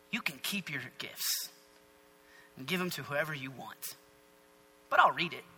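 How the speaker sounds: low, hushed, measured reply; unimpressed refusal. Acted. Daniel declining the king's gifts, quietly unimpressed. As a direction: turn the offer down softly and low.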